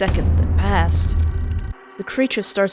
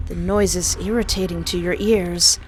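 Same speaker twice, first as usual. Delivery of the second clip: usual speaking voice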